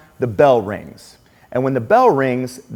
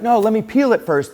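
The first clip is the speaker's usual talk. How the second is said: put-on flustered kid; 8 semitones higher than usual. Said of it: "the kid starts freaking out".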